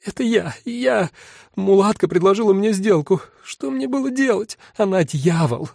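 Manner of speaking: high, breathless desperate pleading; panicked confession. A narrator's reading. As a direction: confess everything, begging to be saved.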